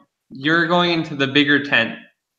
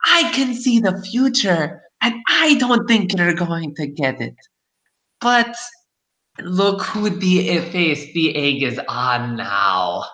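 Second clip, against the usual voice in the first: fortune teller; 7 semitones higher than usual. Acted. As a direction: boast like a gossipy hag. GM keeps crone voice.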